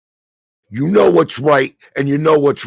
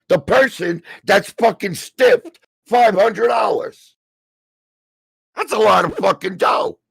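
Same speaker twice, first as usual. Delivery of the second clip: angry shout; 6 semitones higher than usual. Real, not acted.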